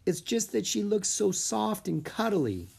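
high, longing voice; wistful, high-pitched child; wistful sigh. A narrator's reading. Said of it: "She let out a big sigh".

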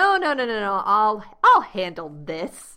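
loud, brash self-quote; overconfident refusal. Retold or imitated. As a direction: brush off help, loudly. Confidently refusing agency help, retold.

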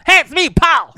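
very high, loud goofy comic voice; hammed-up comedian hello; comedy voice. Shown as a demonstration. Comic introducing himself to the crowd, comedy voice. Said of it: "That should be my comedy voice".

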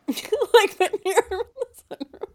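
repeating it through a laugh; amused echo. Real, not acted. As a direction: laugh the words back.

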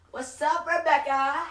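imitated street holler, very high and loud; hammed-up, high-pitched shout; hollered greeting. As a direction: call out like an over-friendly stranger. Man's greeting to passer-by acted out.

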